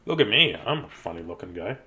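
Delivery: ordinary delivery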